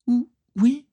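tiny, very high, hesitant voice; stammering little girl. A narrator's reading. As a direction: stammer it like a timid child, pitched high. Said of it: "balbutia la fillette".

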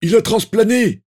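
loud, very high yell; urgent shouted reply. A narrator's reading. A Death Eater answers his comrade mid-battle, loud high shout. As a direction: shout the news back at once. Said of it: "Un autre répondit sans tarder".

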